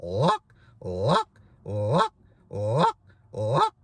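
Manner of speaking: snapping lock goose calls; clucking lock, bass into break. Shown as a demonstration. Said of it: "So it's gonna be like".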